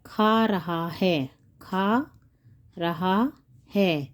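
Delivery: drawn-out words with gaps between; word by word, each है dropping low; slow dictation. Shown as a demonstration. Teacher spelling out the present tense, slow dictation.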